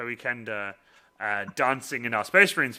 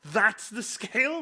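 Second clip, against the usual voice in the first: high, slow incredulous question; drawn-out disbelief; mock amazement; 9 semitones higher, slower than usual. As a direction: imitate someone floored by the size. People realising the size, acted.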